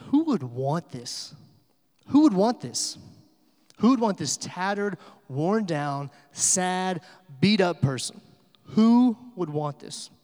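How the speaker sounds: loud, punched rhetorical demand; scornful challenge. Retold or imitated. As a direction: imitate an old preacher challenging the crowd. Old pastor challenges crowd with ruined rose.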